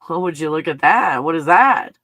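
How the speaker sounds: loud startled question; surprised. Real, not acted. Vlogger spotting something in the video, startled. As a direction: caught off guard by the image.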